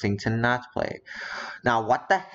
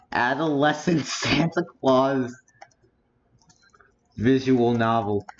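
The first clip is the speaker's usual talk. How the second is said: laughing at the title; 3 semitones higher than usual. Real, not acted. Amused by the game's title.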